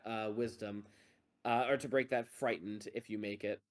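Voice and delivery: ordinary delivery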